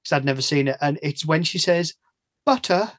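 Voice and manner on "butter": high-pitched imitation of an English accent; Portman's English accent. Podcaster mimics an American actress trying English, mock English accent. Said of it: "when Americans try and do English".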